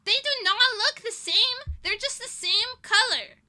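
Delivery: loud, high, indignant protest; pissed off. Real, not acted. Ranting about the roommate, loud and indignant. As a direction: fire it back, really annoyed. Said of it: "I'm so pissed".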